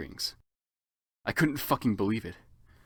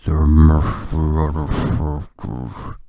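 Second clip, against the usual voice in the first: very low, slow, loud drawl; much lower, slow sinister voice; crisp Snape; 10 semitones lower, 8 dB louder, much slower than usual. Acted. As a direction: intone it coldly. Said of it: "Snape said in a crisp voice".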